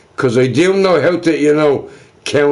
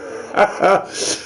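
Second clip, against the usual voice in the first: breathy laugh; 6 semitones higher, 4 dB quieter than usual. Real, not acted. Laughs at own joke.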